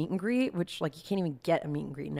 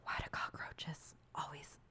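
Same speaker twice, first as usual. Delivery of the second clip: put-on drunk whisper; 3 semitones lower, 19 dB quieter than usual. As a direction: whisper it like a tipsy conspirator at two in the morning. Drunk whisper quote at 2am.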